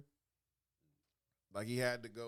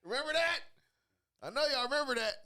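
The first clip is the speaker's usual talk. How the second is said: much higher and louder; excited recall; 13 semitones higher, 8 dB louder, faster than usual. Real, not acted. Reminding friends of the nineties, excited and loud. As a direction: hype the crowd up.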